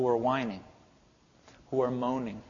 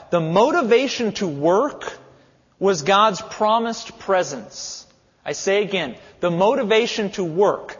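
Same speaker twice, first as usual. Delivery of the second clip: preaching; 3 semitones higher, 3 dB louder than usual. Real, not acted. Preaching the key point.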